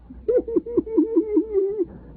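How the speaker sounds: high, delighted laugh. Real, not acted. Preacher laughing at the kids' mistaken kiss, high. After kissing prank.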